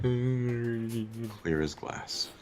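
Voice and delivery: half-whispered, very slow; slow, drawn-out. Pretend. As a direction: stretch each word out slowly. Drawn-out quiet repeat.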